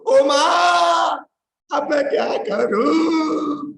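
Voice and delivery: high, drawn-out wail of panic; a man hanging from a tree wails for help; panicked cry. Acted. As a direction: cry out for mother, dangling in fear. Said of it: "ऐसे चिल्लाने लगे".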